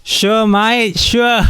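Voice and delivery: Aussie twang, high and bright; Australian accent. Pretend. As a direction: throw on a playful Australian accent. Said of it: "what's with that Australian accent?".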